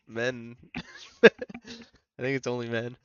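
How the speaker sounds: ordinary talk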